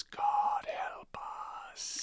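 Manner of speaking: eerie whisper from beyond; ghostly whisper. Retold or imitated. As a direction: whisper it like a ghost haunting the room. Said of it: "a ghostly voice that comes in and says".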